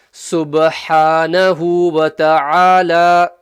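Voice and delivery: SUBHANAHU WA TA'ALA loud, slow, throaty ha; mid-throat ha recitation. Shown as a demonstration. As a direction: recite SUBHANAHU WA TA'ALA with long held vowels. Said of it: "You got the ha again".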